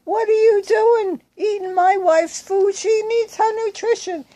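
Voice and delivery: husband berating the man over his wife's food; retold furious yell. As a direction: yell it high at the man eating her food. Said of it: "He started yelling".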